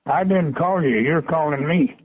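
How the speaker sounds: ordinary talk, nothing marked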